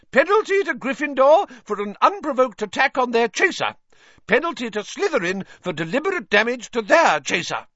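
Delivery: high, loud official ruling; stern referee ruling. A narrator's reading. Madam Hooch awards penalties, stern ruling. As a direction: lay down the penalties firmly.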